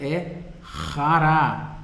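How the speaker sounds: rará drawn out very slowly, rasping the r from the throat. Shown as a demonstration. Teacher gives a memory word: slow, rasping rará. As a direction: stretch out rará with a throaty rasp. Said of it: "não é porque é xará".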